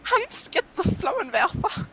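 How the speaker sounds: high, slow German accent; mock German accent. Shown as a demonstration. Showing the game master how, mock German accent. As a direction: put on a drawn-out German voice. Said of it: "how to do a German accent".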